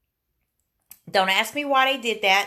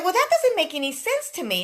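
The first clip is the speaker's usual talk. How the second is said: exasperated; 9 semitones higher, faster than usual. Retold or imitated. Exasperated retort to clerk.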